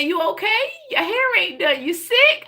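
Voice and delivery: put-on high old Southern grandma voice. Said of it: "she said".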